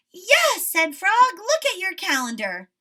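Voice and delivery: high and loud, eager; excited Frog. A narrator's reading. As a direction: point it out with glee.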